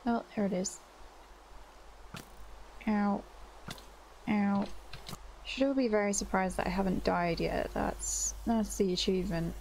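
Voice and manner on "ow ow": usual speaking voice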